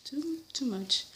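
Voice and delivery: hushed, exasperated whisper; breathy aside to the fleeing guests; retold whisper. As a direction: whisper it in mock despair. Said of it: "I was like".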